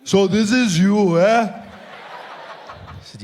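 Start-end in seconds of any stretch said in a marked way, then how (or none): 0.0-1.6 menacing slow greeting, weighing each word; Malema voice. Retold or imitated. Malema greets the comedian at his table, slow and menacing.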